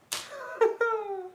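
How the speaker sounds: very high-pitched giggly hoot; high hooting laugh. Real, not acted. Hoots at the thick watch.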